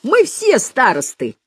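high, drawn-out jubilant cry; gleeful yell. A narrator's reading. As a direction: crow it like rowdy pupils. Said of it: "ликующе завопил Галёрка".

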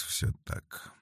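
very soft, voiceless sigh. A narrator's reading. Felix repeating his assent to Darya, sighed almost soundlessly. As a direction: let it fade into a breath. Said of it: "вздохнул Феликс".

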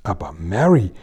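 reproachful. A narrator's reading. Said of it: "rief die königliche Stimme tadelnd".

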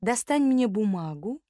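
ordinary delivery, nothing marked

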